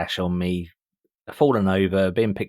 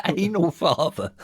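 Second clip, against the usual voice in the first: laughing; 8 semitones higher than usual. Real, not acted. Laughing repeat of phrase.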